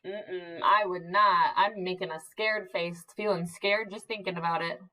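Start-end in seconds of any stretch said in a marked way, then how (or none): none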